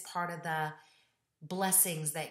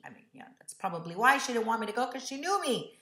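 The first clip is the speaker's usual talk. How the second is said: usual speaking voice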